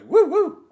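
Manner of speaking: very high, loud hooting woo woo; excited woo woo. Retold or imitated. Coma patient calling out a loud high woo. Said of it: "the guy goes".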